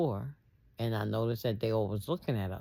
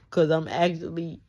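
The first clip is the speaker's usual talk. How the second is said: laughing; 5 semitones higher, 6 dB louder than usual. Real, not acted. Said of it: "I'm laughing now".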